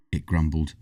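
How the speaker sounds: ordinary delivery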